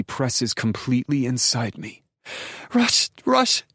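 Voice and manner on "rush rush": high, loud cries of his name; ecstatic scream. A narrator's reading. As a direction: cry the name out at climax, pitched way up. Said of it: "Then I'm screaming and shattering".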